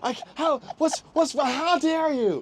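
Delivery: high, loud hammed-up indignation; mock outrage. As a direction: scold them theatrically. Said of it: "he'll scream".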